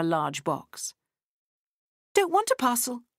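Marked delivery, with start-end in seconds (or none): 2.1-3.0 put-on high, whiny child's refusal; sulky child. A narrator's reading. Freddie refusing the parcel, high sulky child voice. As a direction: pout it like a stubborn little girl.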